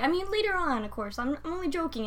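high, quick backpedal; backpedaling mom. Retold or imitated. Imitating boss's mom backpedaling, high and light. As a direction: laugh it off nervously. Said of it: "And she's like".

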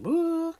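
a sudden high, loud whoop; excited woo. Retold or imitated. Telling how her baby's heart came back, a high excited woo. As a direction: let it fly up like relief.

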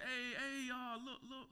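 put-on high, excited greeting of an old friend; friend's greeting. Old friend greets him warmly.

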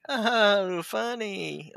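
high-pitched, unconvinced polite reaction; imitated half-hearted amusement; mock polite amusement.

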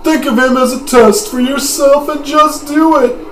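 put-on high, loud old man voice; booming wise old master. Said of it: "That is, that is really not the voice".

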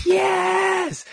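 high, loud whoop of joy; joyful shout. Acted. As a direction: yell it out in celebration. Showing the yell in his trailer.

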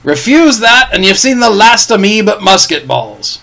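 a pirate wheedling the captain for the chart; Long John Silver. Acted.

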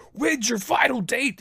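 a clipped demand from the top sergeant; curt question. Retold or imitated. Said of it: "And he's like".

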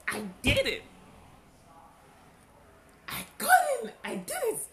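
high, loud triumphant cry; overjoyed. Retold or imitated. Telling her husband she finally did the podcast, joyful high cry. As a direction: shout it out in triumph. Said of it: "I was so happy".

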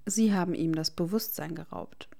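ordinary delivery

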